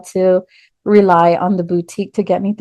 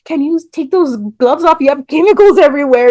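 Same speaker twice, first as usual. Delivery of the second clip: higher put-on voice for the coworker, a bit fast; teasing coworker; 7 semitones higher than usual. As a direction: play the amused coworker pointing out the gloves. Coworker Mark voiced in story.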